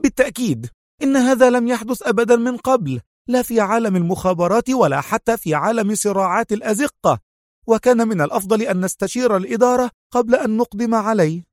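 rising agitation, rushing the last line; excited exclaim. A narrator's reading. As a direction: blurt it out, agitated. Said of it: "فهتفت في انفعال".

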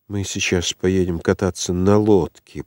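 plain reading voice

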